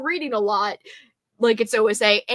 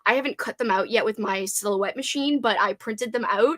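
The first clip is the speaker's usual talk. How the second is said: usual speaking voice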